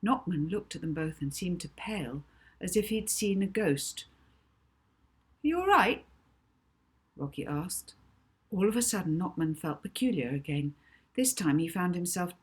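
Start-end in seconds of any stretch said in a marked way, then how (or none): 5.4-6.1 high, louder worried check-in; concerned child. Acted. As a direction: ask it as the anxious kid. Rocky checks on Knockman.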